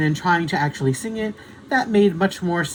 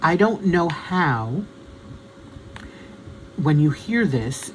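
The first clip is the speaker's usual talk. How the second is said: slow, deliberate self-correction; slow down and say every word properly; speaking carefully; slower than usual. Shown as a demonstration. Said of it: "[name], speak correctly".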